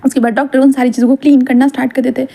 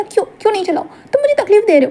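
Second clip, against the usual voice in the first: high whiny pregnant Wing; 9 semitones higher than usual. Acted. Wing and Wangji squabble in raised voice.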